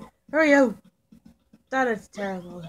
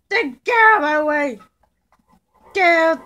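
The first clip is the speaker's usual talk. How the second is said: bossy shout; 5 semitones higher, 8 dB louder than usual. Pretend. Shoving past characters in game.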